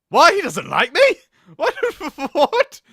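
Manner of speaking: high, loud incredulous what; disbelieving squeal; shocked high laughing disbelief. Real, not acted.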